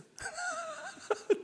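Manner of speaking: a high burst of laughter at himself. Real, not acted. Preacher laughing at his own mock complaint.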